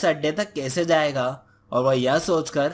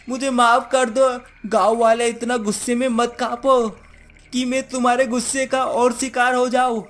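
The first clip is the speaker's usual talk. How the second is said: higher-pitched witch begging for mercy; frightened witch, pleading; 6 semitones higher, 3 dB louder than usual. Acted. Witch mistaking his trembling for anger, fearful pleading. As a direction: plead to be forgiven.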